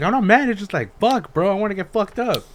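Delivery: high, loud indignant protest; mock angry denial. Retold denial of anger.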